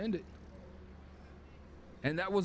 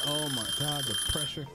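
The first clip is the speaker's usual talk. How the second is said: ordinary talk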